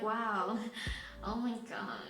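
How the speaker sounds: soft, breath-only flustered murmur; hushed swooning. Retold or imitated. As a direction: whisper it as if overcome, slowing down. Acting out being flustered.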